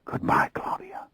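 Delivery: faint whisper. A narrator's reading. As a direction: breathe it so no one hears. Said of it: "I whispered".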